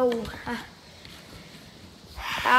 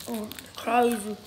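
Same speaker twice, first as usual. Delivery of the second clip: ordinary talk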